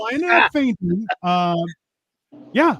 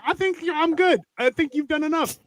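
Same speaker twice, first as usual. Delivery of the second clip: higher-pitched, strained refusal; retold polite refusal; 6 semitones higher, 3 dB quieter than usual. Husband on the ground turning down his wife's help, pitched up. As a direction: wave off help in a raised voice.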